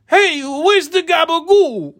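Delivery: very high, slow, hammed-up New York Italian accent. Shown as a demonstration. Mocking Hollywood's fake East Coast casting, New York accent. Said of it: "have some kind of accent that sounds like this".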